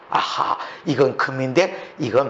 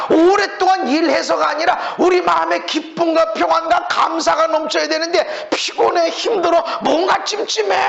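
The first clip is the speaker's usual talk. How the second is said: higher, louder fervent sermon climax; full-force preaching; 12 semitones higher, 4 dB louder than usual. Acted. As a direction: preach it out, rousing the congregation.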